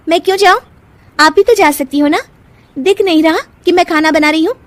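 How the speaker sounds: high-pitched, irritated refusal; defiant. Acted. Nisha refusing errand while cooking, defiant and high. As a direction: push back on the order.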